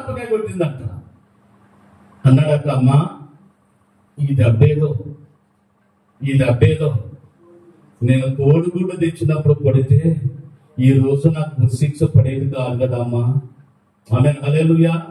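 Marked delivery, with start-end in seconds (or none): 2.7-13.3 grieving son. Retold or imitated. Condemned son speaks to mother.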